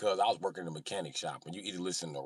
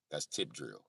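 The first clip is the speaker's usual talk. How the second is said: lower, soft deadpan reply; low-key answer; 5 semitones lower, 10 dB quieter, slower than usual. Retold or imitated.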